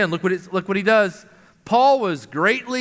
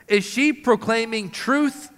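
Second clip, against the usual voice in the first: normal talking voice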